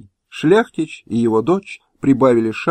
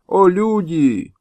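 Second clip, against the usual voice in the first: higher, very slow wailing plea; slow higher wailing call; old man's lament; 7 semitones higher, slower than usual. Acted. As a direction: wail it slowly with arms raised. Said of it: "Старик поднял руки к небу".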